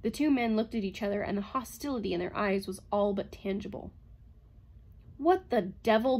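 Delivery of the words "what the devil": sudden louder outburst; startled exclamation; blurted. A narrator's reading. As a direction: blurt it out, exasperated. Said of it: "blurted Bogart".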